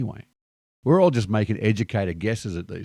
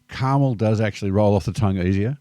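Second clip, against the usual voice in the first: ordinary delivery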